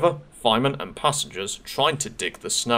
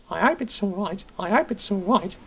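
anxious whisper; 11 semitones higher than usual. Acted. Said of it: "they whispered anxiously".

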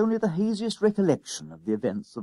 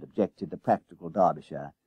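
plain reading voice

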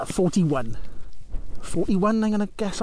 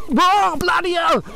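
excited; 8 semitones higher, much slower than usual. Real, not acted. Hill climb on a fast ebike.

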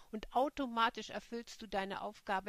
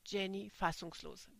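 usual speaking voice

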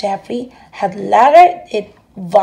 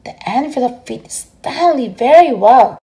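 ordinary delivery

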